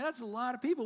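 ordinary talk, nothing marked

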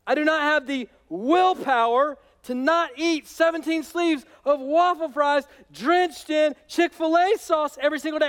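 high, loud, exasperated confession; Paul admitting he can't resist, hammed up; voicing Paul. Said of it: "Paul is saying".

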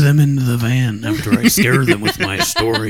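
mock creepy stranger; creepy van man voice. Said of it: "You sound like a pedophile".

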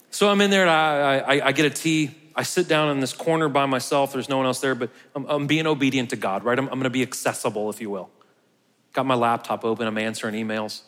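usual speaking voice

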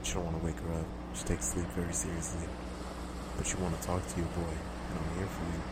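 sultry and hushed, like a secret call; sexy voice. Pretend. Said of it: "It just makes me wanna talk in this sexy voice".

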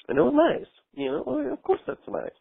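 rises high and airy, wistful and dreamy. Real, not acted. Caller lovingly recalling the moment, high and airy. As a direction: float it up high, remembering the cuddle fondly.

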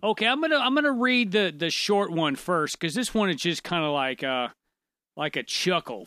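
ordinary delivery

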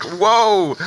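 high, drawn-out whoa; exaggerated taken-aback exclamation; mock shock. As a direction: react as if stunned by an insult. Acts shocked reaction to insult.

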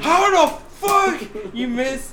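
high, drawn-out incredulous yell; high, slow mock scolding; mock outrage. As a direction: stretch it out in hammed-up disbelief. Acts out outraged reaction to mistake.

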